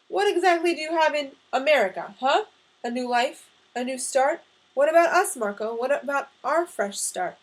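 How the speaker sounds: loud, heated string of questions; pleading challenge. A narrator's reading. Mother confronts son who wants to leave, heated pleading. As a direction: confront him, wounded and insistent. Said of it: "a pleading look in her eyes".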